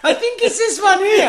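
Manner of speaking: high, loud mock old-lady voice; dotty old dear. Old woman hunting for the switch, high dotty voice. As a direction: imitate a befuddled old woman, high-pitched. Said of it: "this old dear. She was completely mad".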